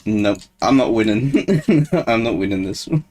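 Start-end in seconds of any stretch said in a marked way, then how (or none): 1.4-2.0 nervous laugh. Real, not acted. Losing to monster.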